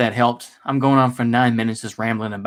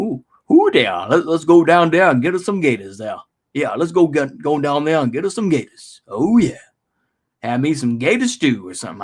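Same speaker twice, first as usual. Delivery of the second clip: hammed-up Louisiana gator hunter; mock Cajun accent; 2 semitones higher than usual. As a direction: play an eager Cajun swamp hunter.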